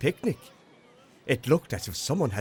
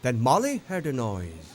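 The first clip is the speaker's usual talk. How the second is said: ordinary delivery, nothing marked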